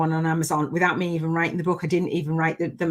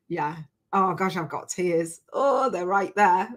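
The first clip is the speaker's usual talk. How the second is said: normal talking voice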